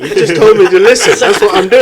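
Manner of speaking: high, loud, laughing comeback; laughing retort. Real, not acted. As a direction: fire it back through laughter. Cheeky retort amid group laughter.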